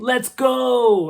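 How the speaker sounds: loud, high drawn-out battle yell; Odysseus battle cry. Retold or imitated. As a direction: holler it like charging into battle. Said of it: "the way that he delivers it".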